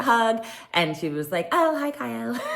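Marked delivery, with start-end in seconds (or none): 1.5-2.4 high, sugary fake-friendly hello; mock sweet greeting. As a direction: play it overly sweet and fake. Mocking Dorit's fake-sweet greeting.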